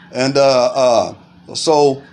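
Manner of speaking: ordinary delivery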